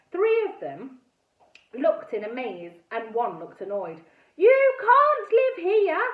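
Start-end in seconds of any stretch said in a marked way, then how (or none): 4.4-6.0 loud, high, slow yell. A narrator's reading. Girl yelling at the buyers, top of her voice. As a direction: shout down at them with all you've got. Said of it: "I yelled at the top of my voice".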